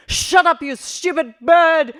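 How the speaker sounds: loud, high shout, drawn out at the end; angry yell. Acted. Mother fed up with half an hour of chirping, angry yell. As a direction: yell at it, pitch leaping up.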